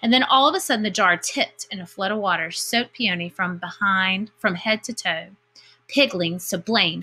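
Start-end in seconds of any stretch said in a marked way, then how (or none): none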